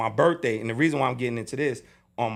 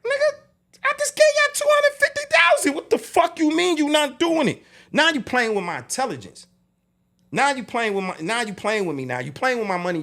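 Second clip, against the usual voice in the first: retold outraged outburst, very high and loud; angry; 13 semitones higher, 6 dB louder than usual. Label boss rages at manager over fifty thousand, angrily. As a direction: voice leaps up in furious disbelief. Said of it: "I'm angry".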